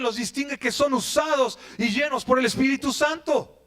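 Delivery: full-force preaching shout; ringing sermon declaration. Real, not acted. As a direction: proclaim it to the whole church. Preacher declares main point.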